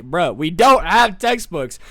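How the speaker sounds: slow, drawn-out exasperated protest; imitating a class at its wit's end; mock exasperation. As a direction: spell it out as a fed-up student.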